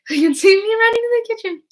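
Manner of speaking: high, loud laughing gush; laughing excitement. Real, not acted. Telling viewers how she runs for the deviled eggs, laughing and high. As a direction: laugh it out, thrilled.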